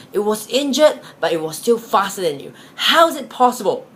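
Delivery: a baffled hunter scolding his dogs, raising his voice; frustrated hunter. Acted. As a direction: hunter berating the dogs who let the rabbit escape. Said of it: "the hunter was saying to the dogs".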